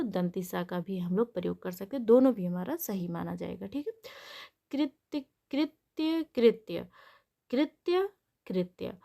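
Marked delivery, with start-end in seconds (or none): 4.7-9.0 careful word drill. Shown as a demonstration. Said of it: "कृत्य का जो शुद्ध रूप".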